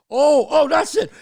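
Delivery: high, loud mock uproar; imitated crowd clamor. Crowd at the club door surging to get in, clamoring loudly. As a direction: burst out like a rushing crowd. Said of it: "everybody would go".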